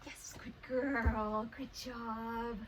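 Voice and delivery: very slow, stretched praise; drawn-out praise. Real, not acted. Trainer rewarding Casey with slow, stretched praise. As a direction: draw the praise out long.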